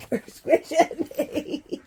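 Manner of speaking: slow, breathless laughing words; laughing while pinned down. Real, not acted.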